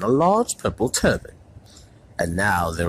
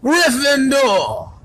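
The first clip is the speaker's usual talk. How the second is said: loud, high, slow cry of Gryffindor; hat shouts; 8 dB louder, much slower than usual. A narrator's reading. The hat calls the house out to the hall, shouting. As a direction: announce the house to the whole hall. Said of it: "heard the hat shout".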